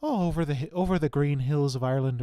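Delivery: loud, lilting Irish key phrase; Irish accent. Shown as a demonstration. Quoting niece's key phrase for an Irish accent, stagey Irish. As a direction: belt out a stagey Irish accent, pitch lifted. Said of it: "her key phrase was something like".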